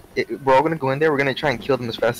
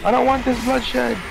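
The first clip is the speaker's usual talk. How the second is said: mock dramatic plea; 11 semitones higher, slower than usual. Mock dramatic line in fight.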